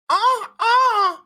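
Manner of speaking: high fake crying wail; hammed-up sob; mock wail. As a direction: wail like a pretend victim. Mock wail after victim line.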